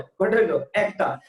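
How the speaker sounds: ordinary talk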